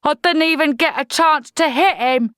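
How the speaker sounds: troll private complaining to officer. Acted. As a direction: moan about it aggrieved. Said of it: "Jade complained".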